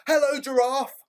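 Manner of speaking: put-on high, bright little-boy greeting; friendly boy. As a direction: pitch up high for a cheerful small boy. Boy greets giraffe in story.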